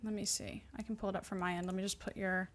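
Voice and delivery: ordinary talk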